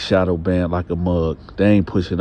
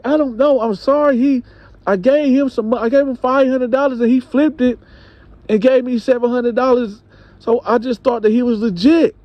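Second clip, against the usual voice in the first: higher, pleading excuses; wife explaining herself to husband, high and pleading; apologetic wife; 4 dB louder than usual. Retold or imitated. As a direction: rush out the excuse nervously.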